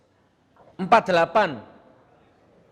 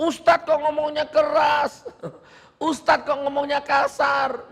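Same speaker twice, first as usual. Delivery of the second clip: high-pitched mocking protest; mock sanctimonious complainer; 7 semitones higher than usual. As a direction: mimic a self-righteous critic, pitched high. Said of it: "Ini ada manusia sok-sok". (menirukan protes orang sok, bernada tinggi)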